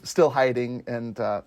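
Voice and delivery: usual speaking voice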